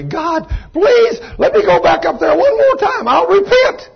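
high, loud, frantic pleading; a damned soul shrieking for mercy; desperate begging shout. Retold or imitated. Damned man begging God from hell.

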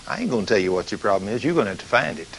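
lightly voiced demon, sneering low; taunting demon. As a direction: demon mocks the failed preacher, low and soft.

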